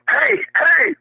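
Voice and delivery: mock yell to get his attention; hey, come back here; calling out. Calling a young fan back.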